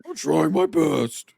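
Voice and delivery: high, loud, drawn-out cracking whine. Acted. Imitating Beardo pleading on his livestream, voice cracking. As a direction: let the voice crack high and slow. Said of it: "Voice cracks".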